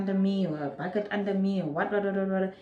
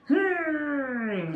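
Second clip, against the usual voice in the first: straining hum; 6 semitones higher than usual. Retold or imitated. Reenacting pushing in labour.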